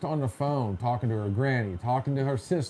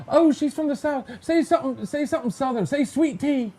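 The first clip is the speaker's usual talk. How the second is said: high-pitched, gushing imitation of eager strangers; mock excited onlookers; 11 semitones higher, 3 dB louder than usual. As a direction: egg her on in a much higher voice. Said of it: "they're like".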